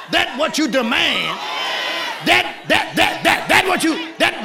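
loud sermon climax; full-force preaching. Acted. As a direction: hammer each that like a rising preacher.

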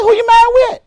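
high, surprised question. Retold or imitated. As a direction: ask it high and startled. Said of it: "I said".

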